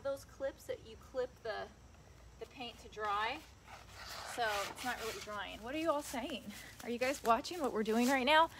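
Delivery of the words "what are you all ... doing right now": ordinary talk, nothing marked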